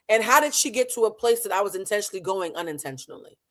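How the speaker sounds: ordinary delivery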